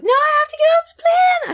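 panicked high scream; freaking out. Retold or imitated. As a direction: shriek to get off the plane. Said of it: "So she just freaks out".

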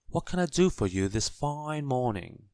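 bright-toned village postmistress at the counter; friendly postmistress. A narrator's reading. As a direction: sing out a welcome to a customer. Postmistress greets customer.

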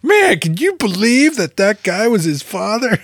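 high-pitched incredulous exclamation; put-on high voice, hammed-up astonishment; mock amazed spoiler. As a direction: blurt it out in feigned amazement. Acting out a loud spoiler in line.

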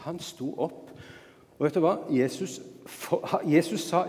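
ordinary delivery

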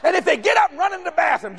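normal talking voice

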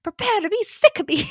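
high, loud mock declaration; Ian's clingy vow, hammed up; hammed-up clingy proclamation. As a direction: proclaim it like an overeager suitor. Repeating Ian line louder.

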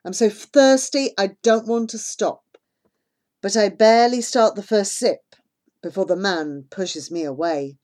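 usual speaking voice

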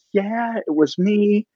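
high, slow, hesitant confession; nervous admission. Retold or imitated. Speaker admitting to the man about cookies, high wary reply.

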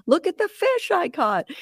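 high-pitched mock holler, held back in volume; proud call across the water, re-enacted; imitating distant yell. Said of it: "and he's yelling".

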